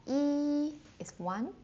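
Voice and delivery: Yi high and level, slow; first tone yi. Shown as a demonstration. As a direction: keep Yi high and flat, slowly. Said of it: "first tone, never drop".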